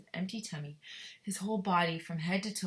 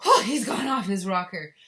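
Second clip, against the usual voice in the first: much louder shout of disbelief, Oh he's gone off his rocker; aghast shout; 3 semitones higher, 12 dB louder than usual. Shown as a demonstration. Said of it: "aghast is like he cannot believe it".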